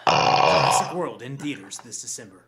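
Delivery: movie trailer voice. Pretend. Mock movie ad line.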